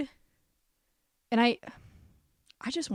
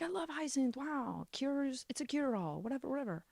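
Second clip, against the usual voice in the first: softer, offhand mock-gushing caption voice; 3 semitones higher, 11 dB quieter than usual. Reading out her own Instagram story, softly and faux-casually. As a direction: toss it off, faux-casual bragging. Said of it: "I was like".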